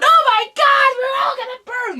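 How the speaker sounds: very high, loud panicked shriek; mock panicked scream. Vlogger mocking khals fleeing the fire, shrill panicked scream. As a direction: squeal like terrified kings. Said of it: "warrior barbarian kings are running around".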